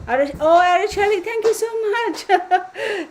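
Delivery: high, loud gush of gratitude; thrilled, slowing into laughter; delighted thanks. Real, not acted. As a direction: gush it, drawing out the last words into a laugh.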